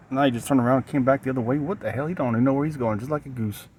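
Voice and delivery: normal talking voice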